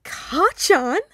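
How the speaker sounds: high, loud squeal of delight. A narrator's reading. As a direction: squeal "Ka-chan?" loudly in glee. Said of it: "Ashido squealed".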